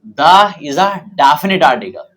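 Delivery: slow dictating voice for notes; teacher dictating a definition slowly; slow dictation. Shown as a demonstration. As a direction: read the definition slowly for writing down. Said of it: "तो definition लिखें".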